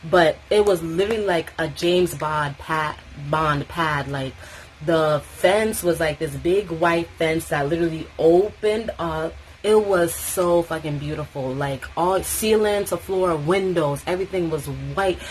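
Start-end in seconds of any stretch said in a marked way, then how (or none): none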